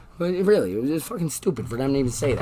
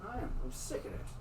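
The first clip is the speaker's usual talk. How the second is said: muttered under breath; 4 semitones higher, 10 dB quieter than usual. Real, not acted. Fed-up muttering.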